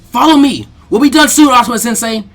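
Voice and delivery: high, loud eager call; rally the team as you go; shouting. Acted. Naruto calling back to his sensei while charging, loud.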